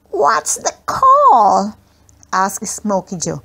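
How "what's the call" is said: put-on high, slow, tremulous child's question; small boy voice. A narrator's reading. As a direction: ask it like a scared little boy, drawn out. Smokey Joe, nervous child.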